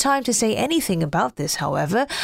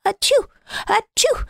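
big sneezes; 12 semitones higher than usual. Acted. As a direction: sneeze hard twice. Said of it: "the snowman sneezing as hard as he could".

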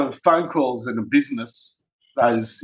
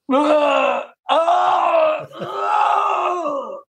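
high drawn-out mock wail; put-on scream; 13 semitones higher, much slower than usual. Prankster faking a fit on the phone, high mock scream. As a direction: let out long, very high fake cries of distress. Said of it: "And then I went like this".

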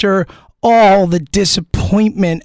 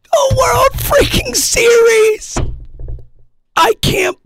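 hoarse, higher excited shouting; hoarse cry; 9 semitones higher than usual. Real, not acted. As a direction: yell it out with a voice worn raw. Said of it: "I have no voice left".

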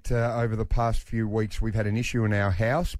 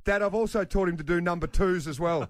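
put-on proud eight-year-old; 6 semitones higher than usual. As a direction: announce it like a proud little trainer. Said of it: "And he goes".

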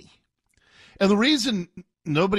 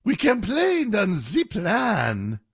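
slow hammed-up French accent, the plan as zee plan; mock her French accent, slowly; a bad fake French accent; 4 semitones higher, slower than usual. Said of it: "It's a bad French accent".

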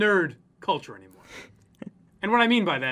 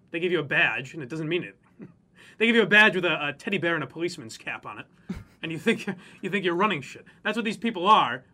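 usual speaking voice

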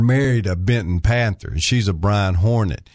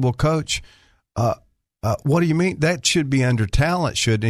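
higher, innocently questioning; mock innocent; 6 semitones higher than usual. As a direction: play dumb with the coach. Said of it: "and I said".

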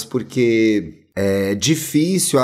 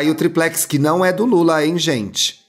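ordinary delivery

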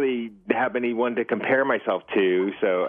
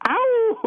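high yelping ow; wolf howl; 4 dB louder than usual. Acted. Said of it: "that's more of a wolf howl".

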